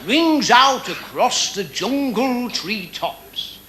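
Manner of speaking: ordinary talk, nothing marked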